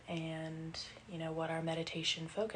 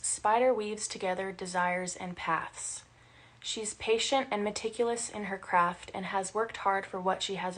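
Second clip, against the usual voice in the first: measured, lightly formal reading of a card's text; a touch of ceremony in the reading; reading aloud from book; 5 dB louder than usual. Acted. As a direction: read it out like an oracle. Reads card text from book.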